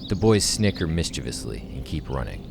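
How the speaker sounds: measured, dramatic playback read; script narration. Acted. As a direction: read the screenplay aloud with weight and pauses.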